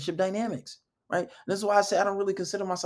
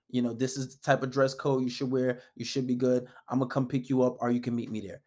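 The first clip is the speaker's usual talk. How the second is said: low, even, assured planning voice; taking charge; 6 semitones lower than usual. Shown as a demonstration. Man leading when asking a girl out, calmly in charge. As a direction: settle the date calmly, pitched low. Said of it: "Take charge".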